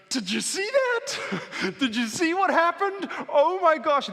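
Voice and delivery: high, loud, laughing amazement; amazed kid. Acted. Kid who just saw the miracle, wildly excited.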